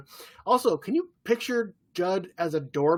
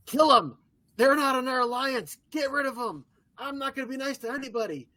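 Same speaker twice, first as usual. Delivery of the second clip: mock aggressive Jamie; 7 semitones higher than usual. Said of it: "He's like".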